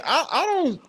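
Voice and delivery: ordinary talk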